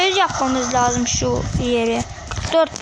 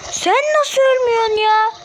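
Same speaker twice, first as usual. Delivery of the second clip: high, louder cry of disbelief; exasperated high cry; 8 semitones higher, 3 dB louder than usual. Real, not acted. Gamer yelling at an immortal enemy, high exasperated cry. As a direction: demand it in outraged disbelief.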